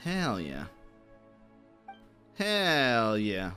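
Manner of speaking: ordinary talk, nothing marked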